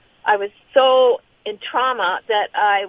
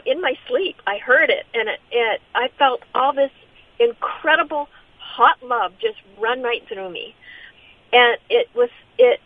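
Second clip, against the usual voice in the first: usual speaking voice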